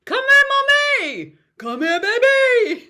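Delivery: very high, loud, slow call of Come here, mommy; playful call. Shown as a demonstration. Teacher showing a silly call students laugh at. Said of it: "have so much fun with it".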